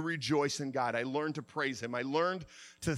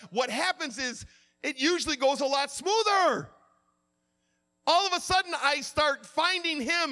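high, raised preacher's voice; impassioned preaching; 9 semitones higher, 5 dB louder than usual. Real, not acted. Preacher teaching about joy in tribulation, impassioned preaching. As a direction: drive the lesson home to the congregation.

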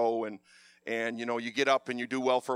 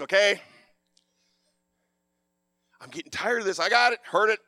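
fed-up snap; 5 semitones higher, 5 dB louder than usual. Retold or imitated. Fed up with wife's nagging.

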